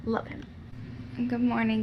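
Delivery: normal talking voice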